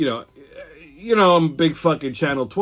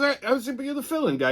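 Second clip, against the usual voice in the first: weatherman's reply; 10 semitones higher, faster than usual. Retold or imitated. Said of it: "He goes".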